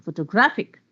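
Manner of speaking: "photographic" with GRAPH stressed; stress on third syllable. Shown as a demonstration. Teacher showing how stress clarifies words, third-syllable stress. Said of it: "The word is on the third syllable".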